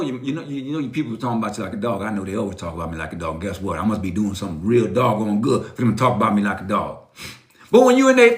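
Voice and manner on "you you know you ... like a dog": low aside. Real, not acted. Drops low, fast aside.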